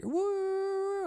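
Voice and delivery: high whooping yell; drunk fan whoop. Retold or imitated. Rowdy fan in front row whooping, very high.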